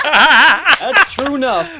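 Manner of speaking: words through high laughter, slow; laughing. Real, not acted. Laughing reply to joke.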